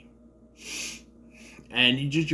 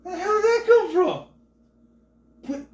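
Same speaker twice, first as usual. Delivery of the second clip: much higher, loud squeal of shock; plain; stunned disbelief; 4 dB louder than usual. Retold or imitated. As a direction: jump out of your seat in disbelief. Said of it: "and I was just like".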